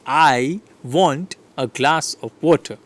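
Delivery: "I", "glass" and "water" stretched out slowly; slow demonstration stressing "glass" and "water"; content words stressed. Shown as a demonstration. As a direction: lean on "glass" and "water", lighter between. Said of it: "here you see the glass and water".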